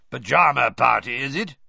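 loud gruff growl. A narrator's reading. Moody growling at Snape and Filch, loud. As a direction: growl it up the stairs. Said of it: "he growled up the stairs".

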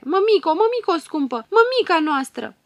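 high, loud anguished cry; heartbreaking screams. Acted. Daughters crying out to their collapsed mother, screaming. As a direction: scream for the fainted mother in terror. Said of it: "scot țipete sfâșietoare".

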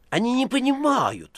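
high, loud busybody butting in; meddling precentor. A narrator's reading. Precentor meddling on the foreigner's behalf, high and pushy. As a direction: cut in eagerly like a sly clerk.

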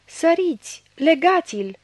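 high, loud yell; howled orders. A narrator's reading. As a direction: shout for them to seize him. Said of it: "urlară într-un glas".

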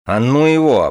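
sudden angry growl. Acted. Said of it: "прорычал внезапно".

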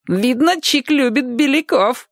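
put-on very high, jeering kid voice; mock him in a high voice; gleeful playground taunt; teasing schoolboy. Schoolboy jeers at Chik.

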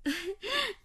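high, quiet giggle; soft fond laugh. Acted. Woman amused by the baby, soft laugh.

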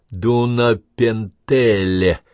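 slow, syllable-by-syllable reading. A narrator's reading. Officer reading a Hungarian town name off the map, slowly. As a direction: spell the strange name out slowly. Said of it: "прочёл он по слогам".

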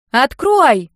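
high, very loud demand; wailing shout; yelling. A narrator's reading. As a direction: yell it at a shut door. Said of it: "завопила сестрёнка".

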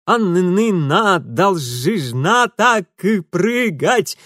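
stuttering through chattering teeth. Acted. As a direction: teeth rattling, slow and broken. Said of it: "простучал зубами Урусов". (стуча зубами)

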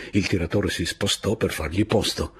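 usual speaking voice

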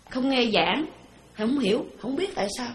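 usual speaking voice

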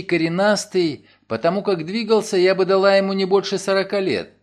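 a worried woman rushing her story; Nancy anxiously explaining to her lawyer; anxious young woman. Acted. Nancy's figure voice.

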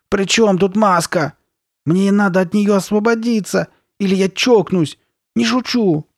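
high, loud, frantic outburst; angry shout. A narrator's reading. Girl desperate to get free, shouting angrily. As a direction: yell it in exasperated desperation. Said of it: "сердито закричала Карли Бет".

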